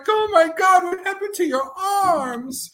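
high, hammed-up dismay; mock horror. Servant quote about master's lost arms, high mock horror. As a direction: cry out in exaggerated alarm, drawing out the words.

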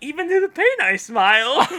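high, loud, giggly cheer; hammed-up chuckle through adversity; over-the-top optimist. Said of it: "over-the-top optimist".

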